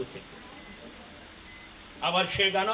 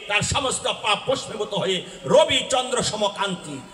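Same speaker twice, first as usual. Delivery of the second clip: ordinary talk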